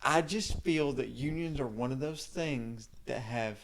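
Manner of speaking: ordinary delivery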